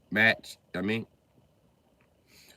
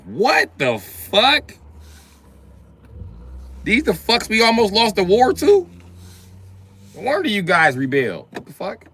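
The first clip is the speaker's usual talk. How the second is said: loud, high-pitched outraged disbelief; shocked disbelief; 11 semitones higher, 8 dB louder, slower than usual. Retold or imitated. American learning the British were butlers, loud disbelief. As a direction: shout it in appalled amazement. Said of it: "I was like".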